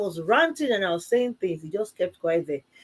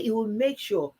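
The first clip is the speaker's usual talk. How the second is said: ordinary talk, nothing marked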